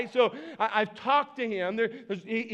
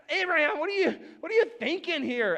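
higher, incredulous question; exasperated disbelief; 6 semitones higher than usual. Retold or imitated. Pastor reacting to Abraham in the story, incredulous. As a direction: call him out in disbelief. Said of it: "I'm like".

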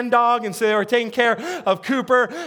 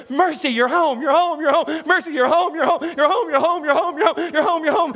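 higher, gleeful puppy voice with laughter; excited dog voice; 5 semitones higher than usual. Retold or imitated. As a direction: voice the dog bouncing with joy, repeating it over and over. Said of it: "Here's what Cooper's doing".

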